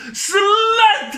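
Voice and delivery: very high, stretched mock yell; hammed-up insult. Retelling Fletcher pointing at Miranda, high mock yell. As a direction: hurl it out high and long, comically.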